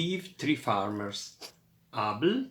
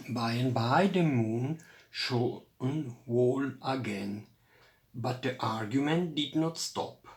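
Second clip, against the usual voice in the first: usual speaking voice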